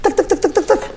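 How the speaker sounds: high, soft clicking tuck sounds; playful high tuck-tuck of a caulking gun; caulk gun noises. Retold or imitated. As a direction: mimic a sealant gun squirting in quick bursts. Imitating caulk gun sealing house.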